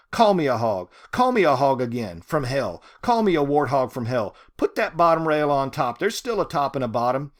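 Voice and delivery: loud, punching each call; loud defiant taunt. Acted. Ruby taunting God across the pasture, loud defiance. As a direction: roll it out over the pasture, daring. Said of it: "her voice rolled out over the pasture".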